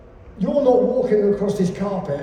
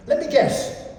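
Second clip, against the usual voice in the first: usual speaking voice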